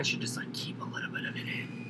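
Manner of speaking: very soft whisper; under the breath. Shown as a demonstration. Host admitting he should filter himself, whispered under his breath. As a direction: drop right down into a private whisper.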